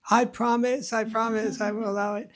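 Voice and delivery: very high mock pleading; frantic promise. Mother-in-law promises the snake, mock frantic plea. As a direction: squeal it out, desperate and hammed-up.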